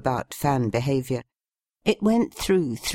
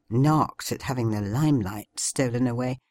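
plain reading voice

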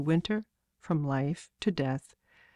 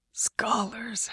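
breathy whisper; whispered scoff; 5 semitones higher, 5 dB quieter than usual. A narrator's reading. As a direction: scoff it under your breath. Woodcutter scoffing at scholars.

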